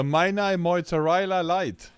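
loud, high, drawn-out mock announcement; hammed-up loud call. Streamer hams up a silly product name to chat, hammed-up loud call. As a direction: belt it out slow and big.

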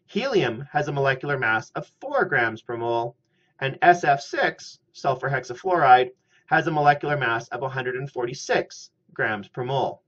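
usual speaking voice

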